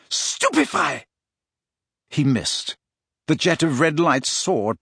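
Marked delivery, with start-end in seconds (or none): 0.1-1.1 high, urgent incantation; yelled curse, pitch leaping up; Harry's yelled spell. A narrator's reading. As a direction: fire off a spell in a high yell. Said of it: "took aim at Snape's back and yelled".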